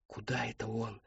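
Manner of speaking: very soft, hushed wondering; whispered question. Acted. Boy watching the deer leave, whispered question. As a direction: whisper it so the deer won't hear. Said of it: "прошептал Мэтт".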